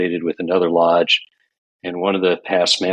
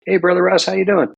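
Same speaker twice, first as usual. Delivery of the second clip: much higher, cheery phone greeting as Dave; higher voiced friend on the phone; voicing Dave; 12 semitones higher, 3 dB louder, faster than usual. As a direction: play the friend answering brightly.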